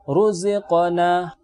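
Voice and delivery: slow recitation with ruziqana's ra full and heavy; thick ra. Shown as a demonstration. Tajwid teacher showing students a thick ra: slow, heavy recitation. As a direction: draw out ruziqana, filling the mouth on ra. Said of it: "maka dibaca tebal".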